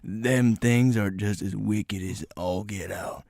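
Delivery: deep, slow, dramatic warning; ominous strange guy. Retold or imitated. Strange guy warning about wicked raccoons, low and ominous. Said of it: "talking like that".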